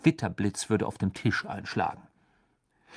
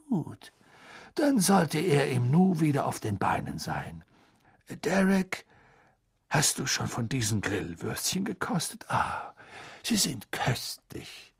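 put-on gentle old headmaster; Dumbledore voice; 2 semitones higher than usual. A narrator's reading. (sanfter alter Zauberer)

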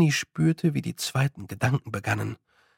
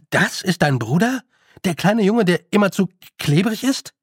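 put-on higher, disbelieving boy's voice; puzzled boy, pitched up and energetic; incredulous boy Roland; 6 semitones higher, 4 dB louder than usual. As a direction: question it in young disbelief.

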